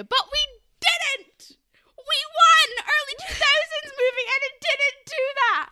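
high, loud victory yell; triumphant shout. Real, not acted. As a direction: cheer it out like a win, stretching the words. Celebrating the movie avoiding fat jokes.

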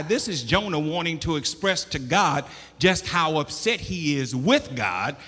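loud, high, driving preacher's cry; preaching at full force. Acted. Preacher thundering Jonah's complaint to the church. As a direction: preach it hard to the congregation.

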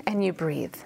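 whispered, letting go. Real, not acted. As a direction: hush it like an exhale. Calming invitation to rest.

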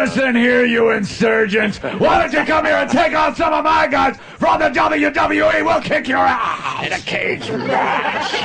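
high, shouting wrestling-boss voice; Vince McMahon impression. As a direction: bellow it like a ring promoter. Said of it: "Who the hell talks like that?".

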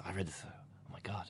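barely audible mutter, almost whispered; hushed aside. Real, not acted. Reader breaking off the email in disbelief, hushed aside. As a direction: drop to a tiny under-the-breath aside.

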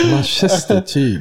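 drawn-out mocking echo of his word; mock slow repeat. Mocking his friend's odd word, slowly. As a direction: stretch the word out slowly like a joke. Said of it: "sa han nu precis till mig".

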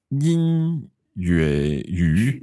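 drawn out 英语, syllable by syllable; slow careful Mandarin. Shown as a demonstration. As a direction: sound out 英语 very slowly.

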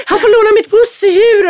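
high, loud nervous cry; nervous shout. Retold or imitated. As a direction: blurt it out in a nervous panic. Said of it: "Pelle blir helt nervös". (nervös lillebror ropar högt)

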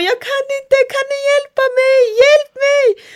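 very high squealed plea for help; high-pitched retold panic; panicked plea. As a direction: beg helplessly, building to a loud cry.

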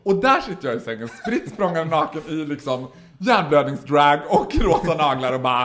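ordinary talk

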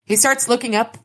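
ordinary talk

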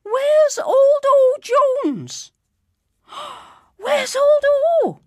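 very high, loud, slow alarmed call with a gasp; old man with the list calling for O Jones. A narrator's reading. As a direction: raise the alarm for the missing man. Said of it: "called out loud".

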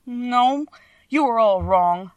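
usual speaking voice

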